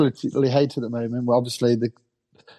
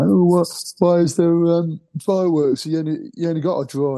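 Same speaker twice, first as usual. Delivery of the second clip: higher-pitched questioning quote of a rival fan; put-on puzzled fan voice; mock baffled fan; 6 semitones higher than usual. Mimics a rival fan's complaint.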